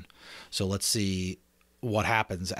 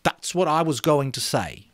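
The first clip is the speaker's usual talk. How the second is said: Ralph; 7 semitones higher, 4 dB louder than usual. Acted. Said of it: "he went on".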